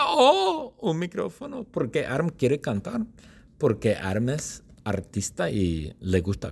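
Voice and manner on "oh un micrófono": very high excited cry; excited squeal. Acted. [name] spotting the microphone, high excited cry. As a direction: squeal at the sight of it.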